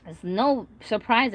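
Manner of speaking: ordinary delivery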